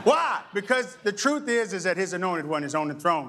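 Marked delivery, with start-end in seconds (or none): none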